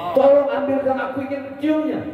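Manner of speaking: high, fading plea; high longing plea trailing off; pleading traveller. Acted. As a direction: beg to kiss it.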